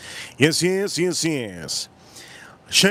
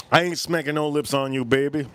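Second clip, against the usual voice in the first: ordinary talk, nothing marked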